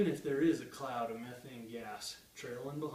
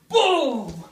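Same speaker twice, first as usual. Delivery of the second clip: loud boom; 10 semitones higher, 18 dB louder than usual. Acted. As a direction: shout the boom, high and loud. Bar hits him in poem.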